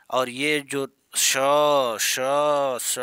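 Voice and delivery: श slow and hissed with strong air; hissing श demo. Shown as a demonstration. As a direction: hiss श श श out slowly. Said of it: "ये महाप्राण हो गए".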